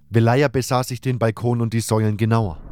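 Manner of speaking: ordinary delivery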